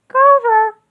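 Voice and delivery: high, sing-song Miss Ticky. A narrator's reading. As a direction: call him like a chirpy teacher. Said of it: "said Miss Ticky".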